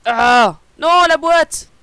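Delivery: loud, high yelp of dismay; dismayed cry. Real, not acted. Streamer tearing the mouse box, loud dismayed cry. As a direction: cry out at a sudden mishap.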